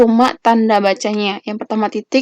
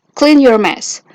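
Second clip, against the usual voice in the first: firm command; 3 semitones higher, 4 dB louder, much slower than usual. Shown as a demonstration. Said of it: "kesannya lebih tegas".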